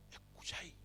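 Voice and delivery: hushed, whispered question; imitated whisper. Disturbed churchgoer asks the pastor about sounds, in an imitated whisper. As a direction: whisper it as if straining to hear. She confides hearing a distant crowd.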